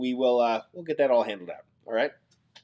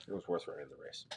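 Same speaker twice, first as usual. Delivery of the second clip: the line again, much softer, almost to himself; quiet echo; 9 semitones lower, 20 dB quieter, faster than usual. Real, not acted. Host echoing his consolation to the losing viewer, barely audible. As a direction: repeat it under your breath.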